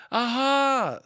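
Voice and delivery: high, loud aha; a poor aha. Acted. Piglet trying to scare Kanga, high loud aha. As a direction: try to sound triumphant and miss. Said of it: "said Piglet again".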